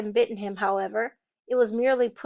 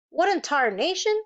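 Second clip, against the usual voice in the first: higher, startled exclamation; exclaiming; 6 semitones higher than usual. A narrator's reading. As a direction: blurt it out in surprise. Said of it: "the tall man exclaimed".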